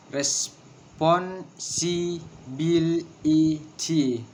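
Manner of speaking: "Responsibility" very slowly, broken into syllables. Shown as a demonstration. Teacher counting out six syllables, syllable by syllable. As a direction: hold each syllable apart. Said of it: "six syllables".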